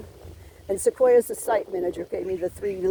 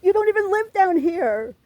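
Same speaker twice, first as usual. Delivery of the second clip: higher, slow, near tears, begging to be left alone; tearful, pitched-up plea that breaks by the end; upset pleading; 7 semitones higher, slower than usual. Retold or imitated. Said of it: "and I fought back finally".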